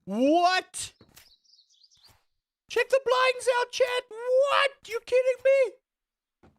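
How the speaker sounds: very high, loud squeal of disbelief; astonished shout. Real, not acted. Streamer showing chat what is outside the blinds, astonished. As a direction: shout to chat in soaring amazement.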